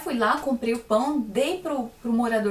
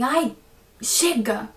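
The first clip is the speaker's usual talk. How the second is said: exasperated, sharp and a bit louder "Ai, chega!"; fed-up outburst; 4 dB louder, much slower than usual. Retold or imitated. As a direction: brush the nagging thought off impatiently. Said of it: "Fiz assim, desse jeito".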